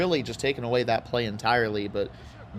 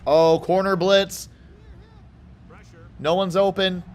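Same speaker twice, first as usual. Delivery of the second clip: surprised alarm; 7 semitones higher, 5 dB louder than usual. Real, not acted. Surprise blitz.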